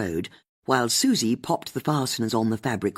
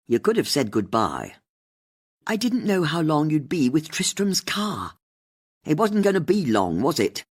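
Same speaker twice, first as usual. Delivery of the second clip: bickering; 4 semitones higher than usual. A narrator's reading. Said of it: "Susie and Bex bickering".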